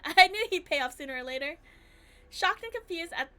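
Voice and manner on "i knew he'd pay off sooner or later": gleeful shout. Retold or imitated. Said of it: "shouting things like".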